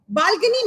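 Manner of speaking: Balcony said with a rising question tune; rising inflection. A narrator's reading. Teacher reading Max's suspicious one-word question. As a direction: lift the end of Balcony into a question. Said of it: "with a risking inflection".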